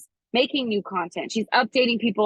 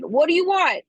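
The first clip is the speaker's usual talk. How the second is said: Noah's plea, louder and higher; hammed-up movie-star plea; Ryan Gosling impression; 6 semitones higher, 5 dB louder than usual. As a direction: demand it like the movie hero in the rain. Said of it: "My best Ryan Gosling".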